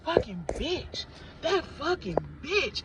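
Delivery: drawn-out, lightly voiced scorn; spirit's voice. Spirit cursing the coworker again, slow and drawn out. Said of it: "I heard Spirit, they was like".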